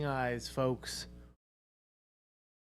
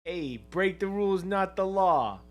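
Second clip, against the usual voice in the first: New Yorker; 6 semitones higher, 5 dB louder than usual. Pretend. Repeats the New Yorker bit.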